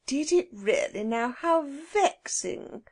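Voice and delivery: kind, slow, sympathetic; kindly. A narrator's reading. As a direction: coo it gently to the children. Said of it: "said Missus Biddle kindly".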